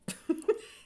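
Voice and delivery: short high laugh; a quick amused laugh; light laugh. Real, not acted. Laugh amid explanation.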